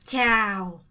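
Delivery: 짜오 falling all the way down, loud. Shown as a demonstration. Teacher models greeting, loud falling tone. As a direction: drop 짜오 to the bottom of the voice. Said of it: "끝까지 내리세요".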